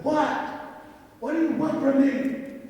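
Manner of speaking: high, loud demand; acting out an exasperated outburst, pitch jumping up; mad. Man confronting his stubborn horse, mad and demanding. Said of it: "I got mad".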